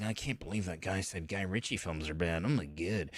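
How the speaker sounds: soft, low, put-on voice of an offended fan; mock the pearl-clutching fan, hushed and low. Vlogger mocking fans who defend Guy Ritchie, soft and low. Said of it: "people are like".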